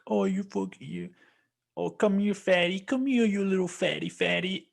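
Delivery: put-on high cooing baby talk to a dog. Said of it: "how people are like".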